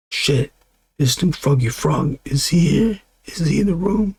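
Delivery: a hammed-up angry father searching the house; mock yelling dad. Dad hunting for the rapper, climbing to a high mock yell. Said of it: "the dad ... yelling".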